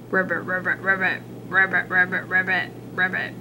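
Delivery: low, soft croaking frog; low frog voice going ribbit. Acted.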